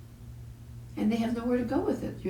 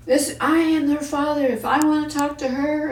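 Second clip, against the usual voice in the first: angry father; 7 semitones higher, 6 dB louder than usual. Retold or imitated. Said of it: "And he goes".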